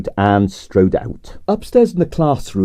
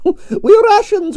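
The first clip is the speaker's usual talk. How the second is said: high, slow laughing Russian boast; Russian diva amused by her own nation; much slower than usual. Acted. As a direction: laugh through the words. Laughs while declaring.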